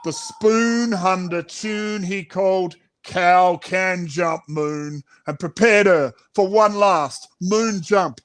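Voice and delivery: usual speaking voice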